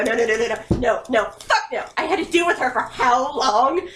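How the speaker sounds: usual speaking voice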